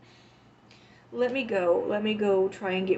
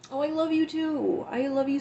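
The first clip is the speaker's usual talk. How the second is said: touched; 8 semitones higher, 4 dB louder than usual. Real, not acted. Thanking a viewer on stream.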